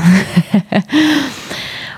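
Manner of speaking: amused laugh before restarting; laughing at own slip. Real, not acted. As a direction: laugh after the flubbed line. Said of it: "Okay, nochmal".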